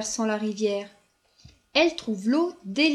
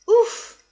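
relieved puff; 12 semitones higher, 8 dB louder than usual. Acted. Raccoon arrives just in time.

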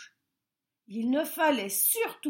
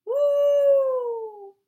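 very high, loud hooting owl; angry owl hoot; 14 semitones higher, 10 dB louder, much slower than usual. A narrator's reading. Owl woken by the bear, very high loud angry hoot. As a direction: hoot in fury. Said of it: "ulula le hibou, très fâché".

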